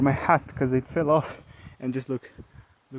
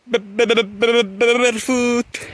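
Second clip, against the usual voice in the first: yelping as bare feet hit sharp stones; pained stammer; 10 semitones higher, 6 dB louder than usual. Real, not acted. Barefoot vlogger yelping on rocks, high pained stammer.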